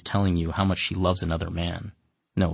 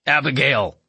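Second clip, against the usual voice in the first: grunting monster; 8 semitones higher, 5 dB louder than usual. Acted. Monster grunting at the narrator.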